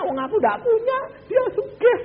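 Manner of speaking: high, loud mock wail; whining complaint. Preacher mimics a poor spouse in a high whining voice. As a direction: play the poor man moaning about his rich partner.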